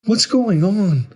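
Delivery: high, loud, alarmed cry; drawn-out frightened shout. A narrator's reading. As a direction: cry out in mounting fear. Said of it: "I finally cried out".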